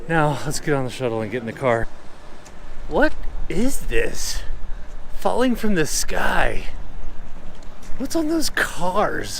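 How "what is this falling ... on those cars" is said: slow, high, loud disbelief; stunned, drawing out each question; surprised. Real, not acted. Surprised by snow after landing.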